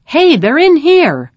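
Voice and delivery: high, drawn-out calling voice; acted yell. A narrator's reading. A pursuer alerts others to the hiding boys, yelling. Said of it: "someone yelled".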